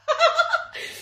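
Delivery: shrill burst of laughter; real laugh; high squealing laugh. Real, not acted. Laughs again at the memory.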